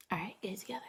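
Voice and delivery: whispered pep talk to herself; self-soothing whisper. Real, not acted. Streamer trying not to cry on camera, whispering to herself. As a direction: whisper it privately, pulling yourself together.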